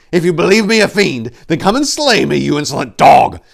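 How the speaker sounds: high, loud furious dare; loud furious taunt; angry challenge. A narrator's reading. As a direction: spit it as a challenge. Challenging accuser to fight.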